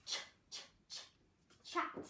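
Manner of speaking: ch, ch, ch, then chat, the ch bursts hushed; segmented ch. Shown as a demonstration. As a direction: tap out the ch before the word. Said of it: "What can you hear".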